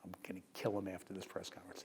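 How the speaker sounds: hushed whisper to the man beside him; whispered threat at a press conference. Retold or imitated. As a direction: lean over and whisper it, low and fast. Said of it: "I turned to him".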